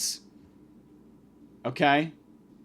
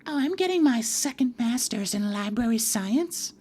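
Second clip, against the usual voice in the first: higher, drawn-out mimicked student with rising end; mock librarian; 6 semitones higher than usual. Student librarian answering what she studies, mocking imitation. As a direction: put on the librarian's voice, pitched up. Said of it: "and she goes".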